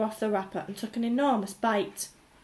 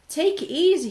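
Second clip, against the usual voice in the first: higher, louder friendly shopkeeper; 7 semitones higher, 7 dB louder than usual. A narrator's reading. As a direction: call out kindly to the boy. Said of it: "he said".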